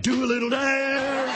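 mock crowd chant; egging on. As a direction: tease him into dancing, slowly. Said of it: "And everybody's like".

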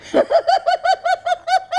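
very high shrieking real laughter. Real, not acted. Laughing hard at her own story about a paranoid woman.

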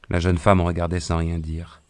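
ordinary delivery

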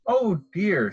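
a mother noticing the broken camera; mother penguin. A narrator's reading. Mother to the chicks, mild dismay. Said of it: "says Mother Penguin".

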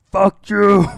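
loud, high mock-furious curse; angry outburst. Real, not acted. Fan ranting at George Lucas, loud furious curse. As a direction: blast it at George.

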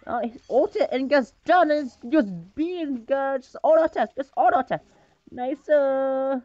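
high, loud excited play-calling; real excitement over a teammate's play. Real, not acted. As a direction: hype up the fight as it happens. Excited live reaction to fight.

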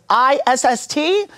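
high, slow mock Indonesian spelling; bad Indonesian accent. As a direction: spell it back letter by letter as an Indonesian caricature. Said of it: "forgive me for this bad Indonesian accent".

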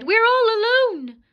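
put-on high, loud panicked child; frightened cry. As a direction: wail it out, terrified. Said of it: "Gretel cried".

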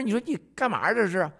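usual speaking voice

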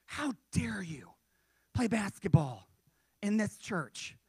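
loud, indignant scolding, drawn-out opening; mock yelling. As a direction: imitate an outraged church member yelling. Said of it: "started yelling at me".

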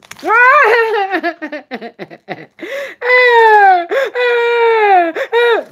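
high, loud wailing cackle; loud, drawn-out mock wail; whooping wail. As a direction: let out a long high shriek breaking into pulses. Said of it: "that's what I really feel like doing".

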